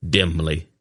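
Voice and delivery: ordinary delivery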